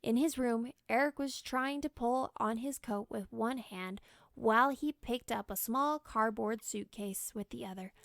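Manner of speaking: ordinary delivery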